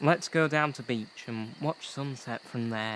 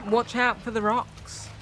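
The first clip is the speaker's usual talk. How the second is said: high-pitched urgent call; calling a warning; 7 semitones higher than usual. Acted. Friend echoing the warning to rowers, calling out. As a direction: send the warning out to the boat.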